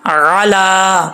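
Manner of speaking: علا with a very long drawn a; recitation demo. Shown as a demonstration. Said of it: "stand زبر لمبا کرنا ہے۔".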